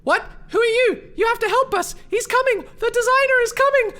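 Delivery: put-on high, loud, frantic child's voice; panicked kid. Kid begging attackers for help, high and panicked. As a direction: cry out for help as a frightened kid. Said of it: "And then the kid says".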